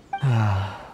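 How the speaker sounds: a long homesick sigh; wistful sigh. Acted. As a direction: sigh for a faraway home. Merchant missing his homeland.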